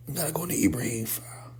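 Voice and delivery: a rushed, breathy mutter while hunting for the message; whispered aside. Real, not acted. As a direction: whisper it fast to yourself, searching. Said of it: "muttering while searching the phone".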